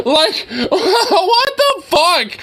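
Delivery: high, slow, laughing "what the fuck"; cracking up mid-sentence; laughing disbelief. Real, not acted. Laughing at absurd arrest.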